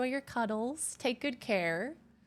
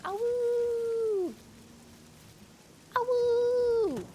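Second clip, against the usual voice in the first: high, held cute wolf howl; little wolf howl; 12 semitones higher, much slower than usual. Acted. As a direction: howl like a small cartoon wolf. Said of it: "He goes".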